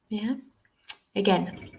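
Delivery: ordinary delivery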